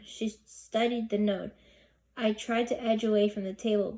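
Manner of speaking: plain reading voice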